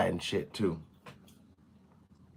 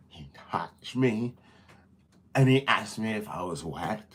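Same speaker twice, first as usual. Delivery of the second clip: mock whisper; 5 semitones lower, 7 dB quieter than usual. Acting an exaggerated accusation in a mock whisper. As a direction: whisper it like a staged confession. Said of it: "There's the female exaggeration".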